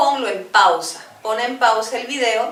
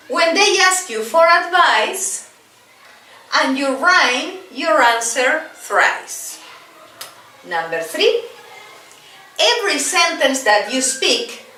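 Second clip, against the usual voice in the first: slow, higher chant with a bouncing beat; rhythmic rhyme recital; 5 semitones higher, slower than usual. Shown as a demonstration. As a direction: recite each line for learners to echo. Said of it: "Number two".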